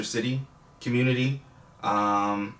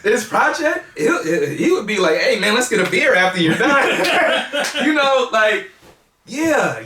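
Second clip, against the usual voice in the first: laughing; 10 semitones higher, 8 dB louder than usual. Real, not acted. Laughing while picturing dad's reaction.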